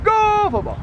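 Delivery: shouted high cheer, stretched slow; booming stadium cheer. Acted. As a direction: chant it like a fan in the stands. Repeated cheer per territory.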